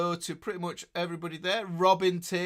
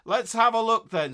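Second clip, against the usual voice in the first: loud, higher Yorkshire "now then, lad"; cheery Yorkshire hail, louder and higher; Yorkshire greeting; 5 semitones higher, 8 dB louder than usual. Retold or imitated. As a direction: echo the sound effect in broad Yorkshire. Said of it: "we've got some sound effects there".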